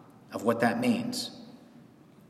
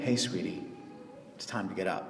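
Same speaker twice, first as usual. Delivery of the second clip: soft and hushed, lower; tender wake-up call; 7 semitones lower, 13 dB quieter than usual. Retold or imitated. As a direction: gently rouse a sleeping child. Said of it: "The one who raises the dead by saying".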